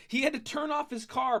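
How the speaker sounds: ordinary delivery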